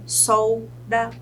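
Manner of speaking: "solda" split into sol, da; syllable by syllable. Shown as a demonstration. Teacher shows two syllables to students, "solda" syllable by syllable. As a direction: pause after sol in "solda". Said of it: "Se ela tem duas sílabas".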